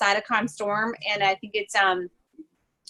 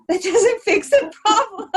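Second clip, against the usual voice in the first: high, slow, laughing disbelief; laughing exasperation; 11 semitones higher, 6 dB louder, slower than usual. Retold or imitated. Retold exasperation at resident.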